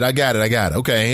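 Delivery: ordinary delivery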